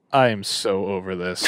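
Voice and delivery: low, bored mock Rossi; bored dismissive imitation. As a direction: drone it like you're done with everything. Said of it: "He would absolutely just be like".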